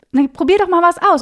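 a voice coach's bright, loud encouragement; upbeat teacher nudge. Retold or imitated. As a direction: egg the student on to try something. Teacher urges student in acted dialogue.

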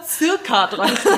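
laughing at own mistake. Real, not acted. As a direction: say it through a laugh. Laughing at own maths slip.

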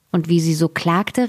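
ordinary delivery, nothing marked

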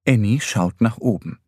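plain reading voice

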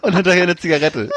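usual speaking voice

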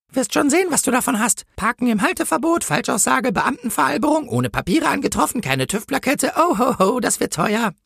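high-pitched, gloating list of offences; officious policewoman. Acted. Policewoman writes up the angel's offences, high and gloating. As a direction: reel off charges in a high policewoman voice.

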